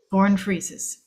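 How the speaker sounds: ordinary talk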